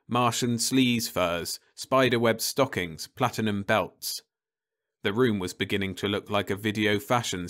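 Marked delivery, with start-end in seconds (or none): none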